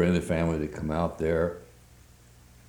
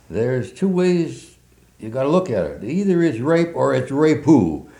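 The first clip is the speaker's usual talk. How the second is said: put-on Southern accent; 9 semitones higher, 9 dB louder than usual. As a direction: ham up a thick Southern accent. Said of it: "with a thick Southern accent".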